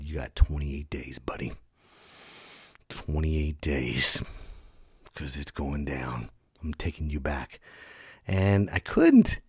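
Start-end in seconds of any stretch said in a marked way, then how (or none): 0.0-7.6 low warning. Retold or imitated. Comic warns new dog of return deadline, low and menacing. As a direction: lay down the deadline low and quiet. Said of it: "I looked at him ... and I said".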